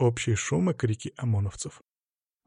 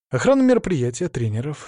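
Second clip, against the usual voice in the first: ordinary delivery, nothing marked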